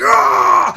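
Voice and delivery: loud, high frustrated yell. Retold or imitated. Window cleaner venting frustration at work, a loud yell. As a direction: let out a fed-up shout.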